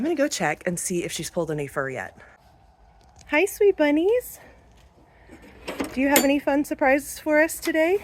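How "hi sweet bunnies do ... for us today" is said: pet talk. Real, not acted. Farmer greeting her rabbits at the hutch, high cooing pet talk. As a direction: coo to the bunnies like babies.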